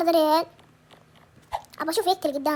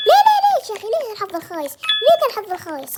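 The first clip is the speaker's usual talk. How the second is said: anguished cry; 4 semitones higher, faster than usual. Real, not acted. Gamer cursing his bad luck, high anguished wail.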